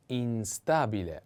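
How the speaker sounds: "instabile" drawn out in separate syllables; slow model pronunciation. Shown as a demonstration. Teacher models the next word for learners, slow "instabile". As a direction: stretch out "instabile" slowly.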